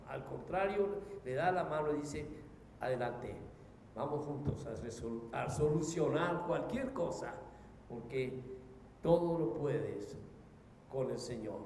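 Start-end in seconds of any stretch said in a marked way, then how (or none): 2.8-11.6 low, soft voice of comfort; hushed, tender encouragement; gentle reassurance. Acted. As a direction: reassure softly, as the Lord to a believer. Voices the Lord encouraging the believer.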